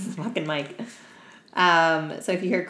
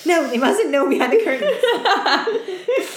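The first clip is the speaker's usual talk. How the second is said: high, slow hammed-up prim voice, laughing; playing a fussy lady in a bonnet; mock posh lady; 6 dB louder, slower than usual. Mocking her own yawn in a bonnet with a high prim voice.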